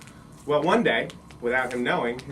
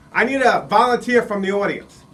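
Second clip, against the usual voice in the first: loud, raised ringmaster-style announcement; head clown; 6 semitones higher, 9 dB louder than usual. Acted. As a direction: boom it out to the whole crowd. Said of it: "head clown came up, he grabbed his microphone".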